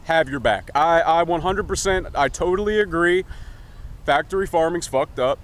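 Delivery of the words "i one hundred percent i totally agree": ordinary delivery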